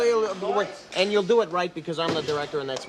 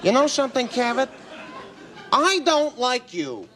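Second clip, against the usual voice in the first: mock outburst; 7 semitones higher than usual. Comic guest telling off the talk-show host, high hammed-up anger. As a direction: storm off at the host, pitched high.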